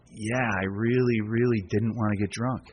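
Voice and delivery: earnest confession. Retold or imitated. Newcomer Mike answering his sponsor Joe, slow and sincere. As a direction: admit it sincerely, drawing it out.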